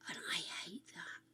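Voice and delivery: soft unvoiced aside; whisper. Real, not acted. Whispering disdain about an old comedian's joke. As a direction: whisper your dislike.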